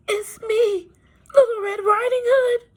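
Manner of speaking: the wolf pretending to be a sweet child; little girl voice. Wolf faking a little girl's voice to fool grandmother. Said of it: "in his best little girl's voice".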